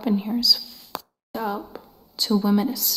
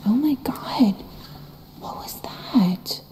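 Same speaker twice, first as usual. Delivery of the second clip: hushed, breathy-free whisper to the camera; scared whisper; slower than usual. Real, not acted. Ghost hunter alone in a dark room whispering to viewers. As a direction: whisper so nothing hears you.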